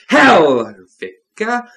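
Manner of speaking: loud, high exclamation of an oath; cursing oath. A narrator's reading. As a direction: blurt the curse out loud. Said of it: "Margaret added an oath".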